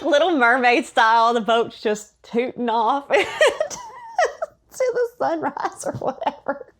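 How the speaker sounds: usual speaking voice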